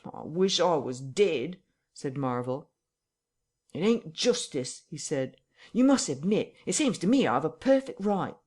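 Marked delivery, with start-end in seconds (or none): 0.0-1.6 despairing whine. Acted. Marvel in despair. 3.7-8.4 higher, louder aggrieved complaint; indignant protest. Acted.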